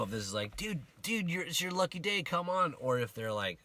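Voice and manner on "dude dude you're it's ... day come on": upbeat urging. Shown as a demonstration. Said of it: "I don't know their tone".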